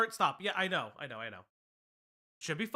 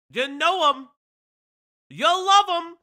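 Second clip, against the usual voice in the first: louder, higher, building to a shout; hype announcement; 5 semitones higher, 7 dB louder, much slower than usual. Acted. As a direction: build the crowd up to the name. Hyping the next guest.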